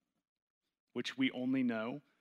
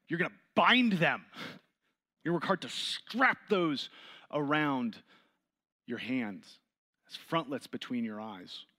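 louder, higher, driving preacher voice; fervent preaching; 4 semitones higher, 5 dB louder than usual. Acted. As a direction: preach it to the room with rising force. Preaching builds on scripture.

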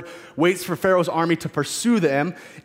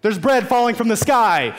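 higher, incredulous mock yell; exasperated outburst; 6 semitones higher, 4 dB louder than usual. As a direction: throw it back at the ungrateful grumblers. Exasperated mock reply to grumblers.

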